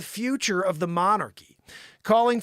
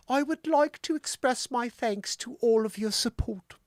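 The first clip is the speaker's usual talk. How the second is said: put-on high English accent, pitched up; the Queen; 4 semitones higher than usual. Host reading the Queen's statement aloud, high English accent. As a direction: put on a hammed-up upper-class English accent. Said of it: "You need a high English accent when you're reading the Queen".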